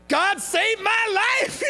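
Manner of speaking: slow, high, blurted-out shout. Retold or imitated. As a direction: blurt it out far too loud and slow. Nervously blurts out his answer.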